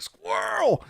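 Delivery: sudden very high-pitched squeal; mock distraction yelp. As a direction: blurt it like a comic distraction. Mock distraction cry while sidetracked.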